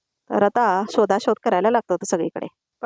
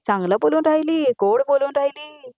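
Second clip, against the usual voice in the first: high, sing-song mocking sneer; jealous sister-in-law sneering at sweet talk, higher mock tone; sarcastic mockery; 6 semitones higher than usual. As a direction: tease her sweetness in a higher voice.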